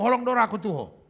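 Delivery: quick, firm "Holong doro haku tuho"; plain Batak tone. Shown as a demonstration. Comic showing the real Batak tone, brisk and firm. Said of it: "nadanya memang gitu".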